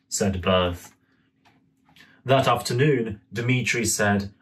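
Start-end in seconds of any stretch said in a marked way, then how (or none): none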